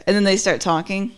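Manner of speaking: usual speaking voice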